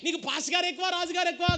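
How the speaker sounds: mocking king raising his voice; king's taunting shout. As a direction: shout the challenge up high like an angry king.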